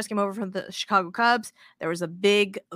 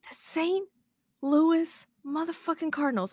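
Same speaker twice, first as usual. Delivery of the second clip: incredulous; 6 semitones higher than usual. Real, not acted. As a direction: say it like you can't believe it. Disbelief at the worst team.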